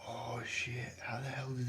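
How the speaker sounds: hushed whisper, breath only. Real, not acted. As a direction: whisper it so the bird won't hear. Sneaking up on a bird.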